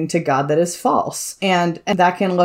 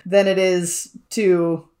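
usual speaking voice

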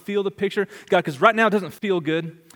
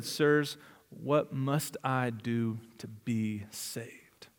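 soft, slow, low trembling question; fearful plea; 7 semitones lower, 10 dB quieter, much slower than usual. A narrator's reading. Terrified jailer pleading with Paul and Silas, soft and slow. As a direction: barely get the words out in fear. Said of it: "trembling with fear".

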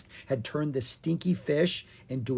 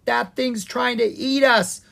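higher, louder frightened shout; 10 semitones higher, 6 dB louder than usual. Acted. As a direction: yell it while fleeing. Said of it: "Owen shouted".

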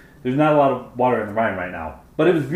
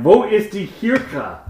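slow, halting schoolbook German, a bit higher; slow clumsy German question; lost tourist German; 7 semitones higher, 4 dB louder, slower than usual. Retold or imitated. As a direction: sound out the German like a lost teen asking strangers. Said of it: "went up to strangers and were like".

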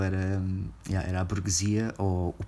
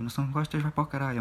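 higher mock comedian taunt; mimicking the comedian; 7 semitones higher, faster than usual. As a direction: toss it at the crowd in a higher cocky voice.

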